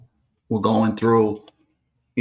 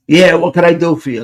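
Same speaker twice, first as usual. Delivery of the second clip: louder, higher Brooklyn tough-guy reply; imitated real hard; 5 semitones higher, 7 dB louder than usual. Anthony answering the caller at his door, real hard. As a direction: play the hard man, challenge the stranger. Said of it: "Like, real hard".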